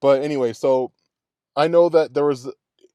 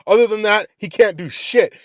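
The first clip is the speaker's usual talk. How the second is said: ordinary talk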